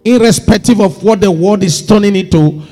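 forceful preaching. Real, not acted. Preacher urges believers not to follow popular opinion, forceful exhortation. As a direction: drive each charge home from the pulpit.

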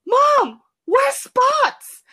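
put-on high kid voice calling to mom; acted child's question. Child asks where puppy went.

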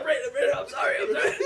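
strained, breathless mock panic; choked pleading. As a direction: beg as if being strangled, half laughing. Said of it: "he's choking me".

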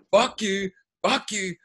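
high, half-laughing imitation of a furious yell; mock screaming. Imitating the actor yelling at the kids, mock rage. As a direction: spit each fuck you like a man losing it. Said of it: "screaming at all of them".

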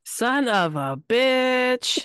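horrified drawn-out swear; drawn-out curse. Real, not acted. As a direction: stretch the curse out in disbelief. Reacts to kidnap story.

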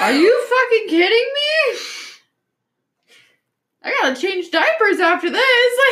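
loud, laughing outrage; laughing disbelief. Real, not acted. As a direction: laugh through the complaint.

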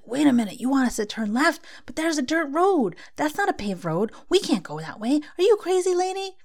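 high, loud, panicky yapping protest; hammed-up frantic guard dog voice; yappy ego dog. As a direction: bark it out like an anxious little terrier. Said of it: "Jake freaks out and starts barking".

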